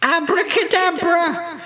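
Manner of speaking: high, ringing witch's incantation; shouted magic spell. A narrator's reading. Winnie waves her magic wand, high shouted spell. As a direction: cry the spell out high, holding the last sound. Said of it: "waved her magic wand and shouted".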